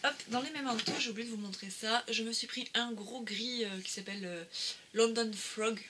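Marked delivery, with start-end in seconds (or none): 4.9-5.8 a jokey, lightly put-on English accent on the name; mock English accent. Naming an ink colour for viewers, in a playful fake English accent. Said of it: "le très très bel accent anglais".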